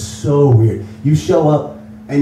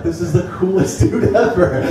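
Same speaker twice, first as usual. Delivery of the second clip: giddy laughing; 7 semitones higher, slower than usual. Retold or imitated. Star-struck inner reaction.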